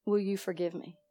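low, hushed, gentle plea; soft voice. Retold or imitated. Mother asking her daughter's forgiveness, soft voice. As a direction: ask softly for forgiveness. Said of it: "Make your voice soft".

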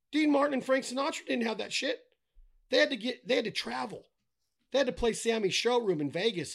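high, loud, heated ranting; worked-up rant. Real, not acted. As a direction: get fired up about it. Heated rant about TikTok fame.

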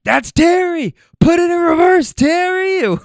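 high, loud imitated holler; retold yelling. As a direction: yell it out like a frantic bystander. Retold panicked yell at Terry.

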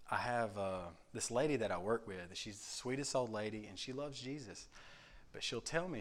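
ordinary talk

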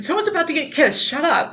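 high, giddy mock swoon; giddy Molina quote. Friend quoting Molina's favourite line, high and giddy. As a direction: gush like a fan who can't wait. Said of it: "I just like that line".

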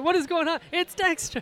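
high-pitched amused disbelief; mock astonishment. Reacting to a friend's toilet-using cat video, mock astonishment. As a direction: exclaim it in mock shock, pitch shooting up.

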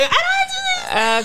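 very high, slow mock shriek; high-pitched outraged shriek; shrieked refusal. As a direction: squeal it out in outrage. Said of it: "I'm like".

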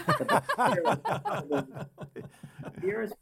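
laughing through the words. Real, not acted. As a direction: laugh while you say it. Laughing mid-sentence about teacher.

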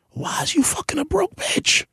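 breathy stage whisper, no voice at all. Acted. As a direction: lean in and whisper the jab. Aside mocking broke men.

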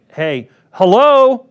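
loud, high mock call for attention. Imitating calling to a distracted friend, loud and high. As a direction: call out to someone who isn't listening.

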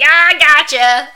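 loud, drawn-out teasing shout; hammed-up gotcha yell; mock prank reveal. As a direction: burst out high and loud, imitating a prankster. Imitating Archie's prank reveal.

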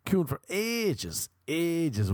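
AGES drawn out long and high; exasperated emphasis. Real, not acted. Complaining to listeners about queuing at the bar, exasperated emphasis.

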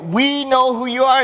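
acted high-pitched outcry; demons crying out. Demons' cry quoted again.

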